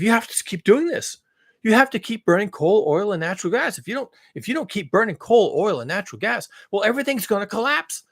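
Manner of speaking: fossil fuel industry. Acted. Industry warning of collapse, mock alarm. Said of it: "like the fossil fuel industry".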